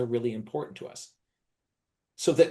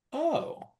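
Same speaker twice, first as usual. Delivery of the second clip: deflated oh; 14 semitones higher, 4 dB quieter than usual. Retold or imitated. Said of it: "they're going like".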